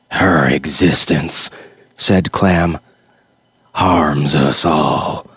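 slow, low, heavy pronouncement; cold zealot's verdict. Acted. Clam condemning the girl to Creature, slow and low. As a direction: drag out the judgment word by word.

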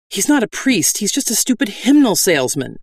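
mild yell up at the bandits; yelling back. A narrator's reading. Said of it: "I yelled back".

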